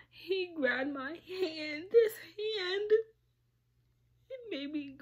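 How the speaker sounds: real crying that breaks through mid-sentence; sobbing. Real, not acted. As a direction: let the voice crack and climb as the crying takes over. Breaking down while recounting.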